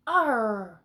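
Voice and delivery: loud pirate growl; a shouty pirate's arr. Acted.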